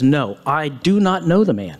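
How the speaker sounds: usual speaking voice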